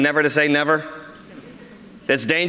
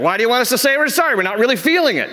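mock man's protest; 7 semitones higher, faster than usual. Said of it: "It's like".

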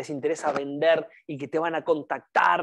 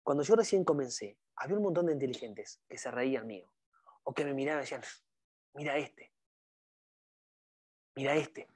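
softly; 3 semitones lower, 8 dB quieter than usual. Shown as a demonstration. Telling doubters' mockery to the trainees, very softly. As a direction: drop the voice right down, soft and gentle. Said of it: "te lo voy a decir suavecito, despacito".

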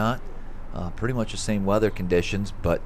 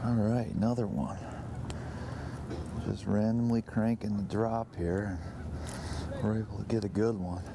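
much softer, almost under the breath; quiet aside; 11 dB quieter than usual. Real, not acted. Quiet aside while fishing.

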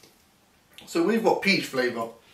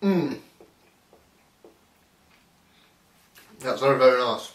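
talking while chewing; eating a snack cake; mouth full; 2 semitones lower than usual. Real, not acted. Seen 1: Bites treat at -5, closed mouth holding it while speaking.

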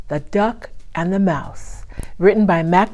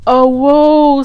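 dramatic lament; 8 semitones higher, 9 dB louder than usual. A narrator's reading. Duck laments doom.

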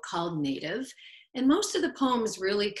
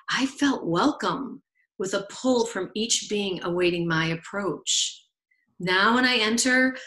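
deliberate, projected poetry recital with phrase pauses; poem reading; 6 dB louder than usual. Acted. Poet recalls first time alone in a forest, deliberate recital. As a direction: recite it with weight on each image. Reads own poem aloud.

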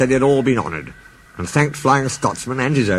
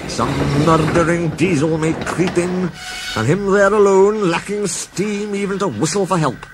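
a higher, slow Scots voice full of foreboding; Scottish engine; 7 semitones higher than usual. Acted. Donald warns the others of a diesel, in a Scottish voice. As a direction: Donald fears for lonely Oliver, in a Scots lilt.

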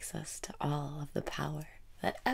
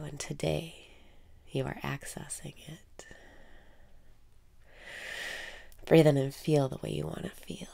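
guided meditation. Acted. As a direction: guide the listener inward, half whispering. Guided self-love meditation.

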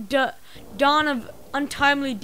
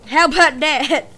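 lightly voiced boss, louder; mob boss voice; 9 dB louder than usual. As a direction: play the boss with a touch of swagger. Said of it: "He talks so weird".

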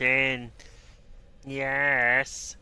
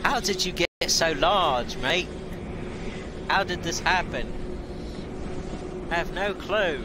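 affectionate cooing at a pet; pet talk; 5 semitones higher than usual. Real, not acted. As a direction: gush over the big cat. Fussing over big cat.

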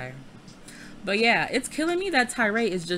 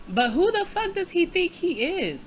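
high-pitched, incredulous indignation; indignant outburst; 10 semitones higher than usual. Real, not acted. Player fed up with [name]'s nerve, indignant. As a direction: demand it in raised, affronted pitch.

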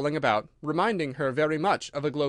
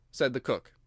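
ordinary delivery

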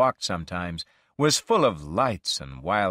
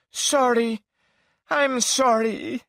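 tearful apology; 14 semitones higher, 4 dB louder, slower than usual. Acted. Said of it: "with tears in her eyes".

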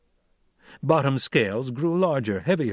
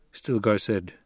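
usual speaking voice

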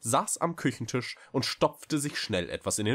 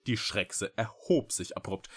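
ordinary delivery

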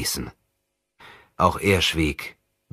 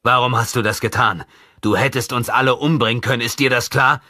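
higher, louder, furious reproach; angry shout; 6 semitones higher, 5 dB louder than usual. Acted. Companion yelling at the reckless boy, angry. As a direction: shout at him for nearly getting everyone killed.

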